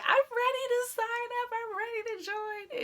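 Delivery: high, fast, eager squeal; can barely contain the excitement; excited. Retold or imitated. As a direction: burst out with giddy eagerness. Eagerly telling friend she'll sign up.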